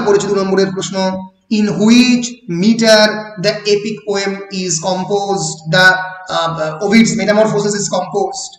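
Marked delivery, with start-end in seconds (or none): none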